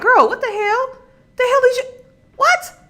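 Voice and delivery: high, shocked disbelief; incredulous. Retold or imitated. Friends reacting to her complaint, shocked high disbelief. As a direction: squeal it like a stunned friend.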